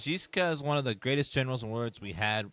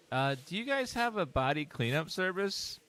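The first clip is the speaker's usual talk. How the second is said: hotel guest; 7 semitones higher than usual. Pretend.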